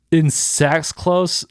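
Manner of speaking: slow, high, disbelieving read; incredulous. Real, not acted. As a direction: drag out the words in disbelief. Reading a weird post aloud.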